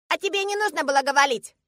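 put-on high, loud childish retort; indignant little girl. A narrator's reading. Girl rebutting father's excuse, indignant. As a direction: argue back like a cross small child.

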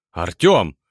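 sharp cry of a name, loud burst then falling; desperate call. Acted. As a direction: call out to a friend in battle. Calling out to friend in battle.

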